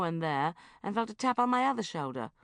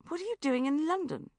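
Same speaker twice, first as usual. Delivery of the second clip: higher suspicious probe; 11 semitones higher, 3 dB louder than usual. A narrator's reading. Bridget questioning her uncle, suspicious. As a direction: ask it warily, eyebrow raised. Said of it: "I said suspiciously".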